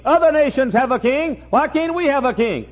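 high-pitched mock complaint; clamor like an envious child. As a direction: imitate a high, pleading demand. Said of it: "Israel clamored for a king".

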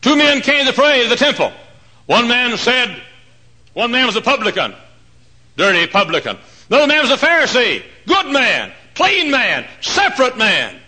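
high, loud pulpit shout; full-force preaching. Real, not acted. Preacher telling a parable of two men, full-force shout. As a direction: belt out each phrase high and loud.

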